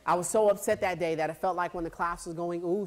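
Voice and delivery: usual speaking voice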